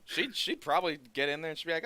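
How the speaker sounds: ordinary delivery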